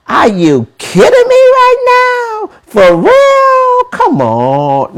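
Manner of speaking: you cannot believe what you heard; incredulous. Real, not acted. Mocking Uncle Jimmy advice.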